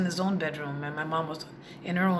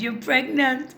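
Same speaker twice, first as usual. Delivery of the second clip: put-on higher, louder Jamaican accent of an old father; 7 semitones higher, 7 dB louder, slower than usual. Father calling his daughter to tell her she's pregnant, Jamaican accent. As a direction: announce it in a Jamaican lilt, sure of himself. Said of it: "He's Jamaican".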